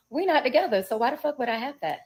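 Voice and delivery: fast, loud exasperated disbelief; indignant. Real, not acted. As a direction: throw the question back, offended. Indignant aside in retelling.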